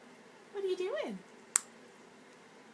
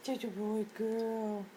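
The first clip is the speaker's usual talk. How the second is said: slow, lower coo to a pet; affectionate, unhurried pet talk; tender praise; 4 semitones lower, 4 dB quieter, much slower than usual. Real, not acted. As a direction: croon soft, drawn-out praise.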